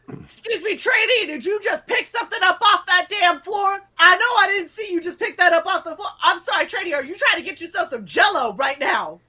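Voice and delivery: high, loud, mocking drill sergeant yell; mock drill instructor. Airman mimics a TI catching a trainee in line, mocking high yell.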